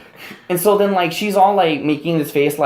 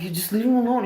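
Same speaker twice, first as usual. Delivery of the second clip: higher, softer put-on wife voice; a lightly voiced scolding wife; mocking wife voice; 7 semitones higher, 6 dB quieter than usual. The wife voiced in a retold fight.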